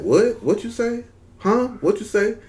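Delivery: high-pitched imitation of baffled listeners; puzzled asking. People asking him to repeat what he said, puzzled. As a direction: mimic a confused "huh?", pitched right up.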